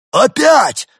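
very high, loud cry; dismayed outcry. A narrator's reading. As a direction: wail it in shock. Demoted sergeant reels in dismay.

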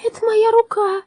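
high metallic giant voice; high robot voice. Acted. Drom's voice continues.

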